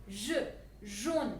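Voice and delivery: jaune with a zh sound as in measure; soft French j. Shown as a demonstration. Teacher modelling the soft j in jaune. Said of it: "which shows the pronunciation j".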